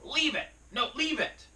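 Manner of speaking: loud, high-pitched frantic yelling; uncontrolled emotional command. Retold or imitated. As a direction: imitate a dog owner losing control, yelling. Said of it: "never learned to control their emotions".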